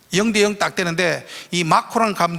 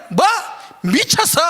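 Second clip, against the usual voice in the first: angry retort; 10 semitones higher than usual. Retold or imitated. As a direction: snap back offended. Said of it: "얼마나 화가 나지".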